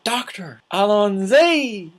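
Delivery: loud, high, drawn-out bad British accent; terrible British accent. Girl hailing the Doctor after the con, terrible British accent. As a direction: imitate a fan calling out in awful British. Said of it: "terrible British accent".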